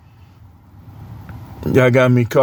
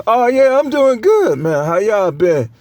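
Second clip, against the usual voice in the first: high, overly sweet friendly voice; chirpy mock politeness; fake nice greeting; 6 semitones higher than usual. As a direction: put on a forced pleasant tone to seem nice. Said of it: "Trying to be nice".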